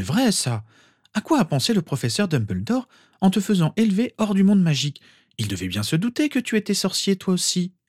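high-pitched outraged questioning; indignant agreement. A narrator's reading. Mother joins in criticising Dumbledore, indignant. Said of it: "elle renchérit".

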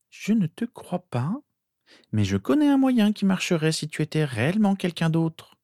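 put-on high girl's voice, measured start; hesitant sister. A narrator's reading. Sister finally offering Harry a test, reluctant.

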